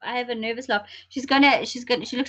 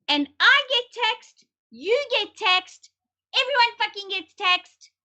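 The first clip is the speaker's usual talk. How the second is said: much higher, slow, each word hammered in outrage; indignant emphasis; 9 semitones higher, slower than usual. Real, not acted. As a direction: spell out the unfairness, word by word. Venting about who pays tax.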